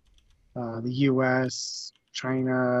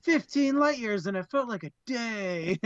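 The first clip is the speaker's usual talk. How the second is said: gleeful boasting; 7 semitones higher than usual. Retold or imitated. Voicing a gleeful Bob.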